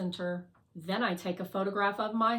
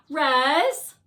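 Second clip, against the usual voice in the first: loud, higher drone voice calling; impatient call; 6 semitones higher, 12 dB louder than usual. Acted. Fly interrupts Resilience at work, loud impatient call. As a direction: call out for attention.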